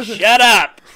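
loud whiny complaint; whiny protest. Retold or imitated. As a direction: groan it at granny. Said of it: "And I'm just like".

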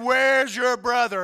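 father confronting son, slow and loud; angry demand. Retold or imitated. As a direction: lean in and demand an answer.

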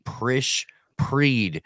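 ordinary talk, nothing marked

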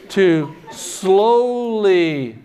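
very slow, stretched high words; slowly drawn out. Retold or imitated. Preacher describing Jesus lifted by sandbags, drawn out. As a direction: stretch it out like a gradual lift.

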